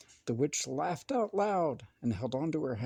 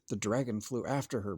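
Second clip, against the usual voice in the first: plain reading voice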